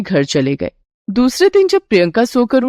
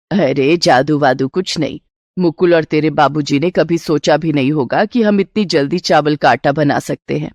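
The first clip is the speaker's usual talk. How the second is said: put-on lower-pitched older woman brushing it off; amused, capable mother-in-law, pitched down; dismissive mother-in-law; 5 semitones lower than usual. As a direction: wave away the question in a low voice.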